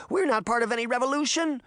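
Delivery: high, urgent plea; desperate pleading denial; begging. A narrator's reading. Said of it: "Sabrina begged".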